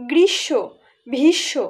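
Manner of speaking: "গ্রীষ্ম, ভীষ্ম"-তে ম অনুচ্চারিত; সঠিক বাংলা উচ্চারণ. Shown as a demonstration. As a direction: say "গ্রীষ্ম, ভীষ্ম" as grishsho, bhishsho. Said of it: "উচ্চারণে জফলার আগমন হচ্ছে". ("গ্রিশ্শো, ভিশ্শো", ম ছাড়া)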